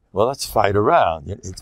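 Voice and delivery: normal talking voice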